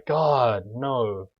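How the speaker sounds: loud dismayed groan; exasperated, blurting it out; exasperated outburst. Real, not acted. Wrong summon cast.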